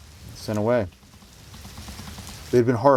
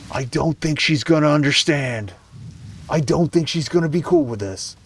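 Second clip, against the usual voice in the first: doubtful interjection; 6 semitones higher than usual. Real, not acted. Reader cutting in on the girl's hopes, openly doubtful. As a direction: break in, pitched up and disbelieving.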